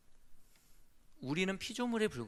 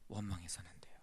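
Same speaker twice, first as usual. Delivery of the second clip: whisper; 8 semitones lower, 9 dB quieter than usual. Real, not acted. Preacher drops to warning whisper.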